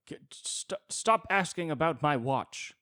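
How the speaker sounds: mock old woman snapping at strangers; cranky old woman. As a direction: shoo them off like a cranky old lady. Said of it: "some old woman like".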